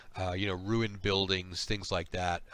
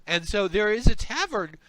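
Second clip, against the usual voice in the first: much higher, hopeful suggestion; eager coaxing; 10 semitones higher, 3 dB louder than usual. Acted. Slug scholar suggesting a nearby tavern, eager coaxing. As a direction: lift the pitch high to coax them along.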